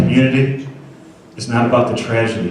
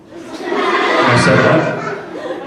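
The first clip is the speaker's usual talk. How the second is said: slow words half lost in real laughter; laughing; much slower than usual. Real, not acted. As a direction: laugh through the question in disbelief. Speaker laughs at chicken remark.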